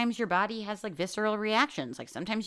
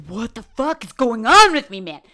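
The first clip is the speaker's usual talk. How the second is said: a much louder, higher mock yell of confusion; loud bewildered outburst; 4 semitones higher, 12 dB louder than usual. To her listeners, playing someone overwhelmed, loud bewildered outburst. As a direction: blurt it out, overwhelmed and baffled. Voicing high-as-a-kite bewilderment.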